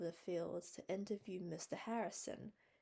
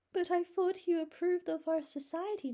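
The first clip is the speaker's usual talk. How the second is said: much higher, louder mournful plea; 12 semitones higher, 11 dB louder than usual. A narrator's reading. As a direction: lament his refusal, pleading. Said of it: "she mourned".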